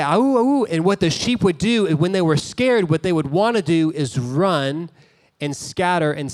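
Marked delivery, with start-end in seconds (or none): 0.0-0.6 high howled call; mock wolf howl. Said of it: "But he would say".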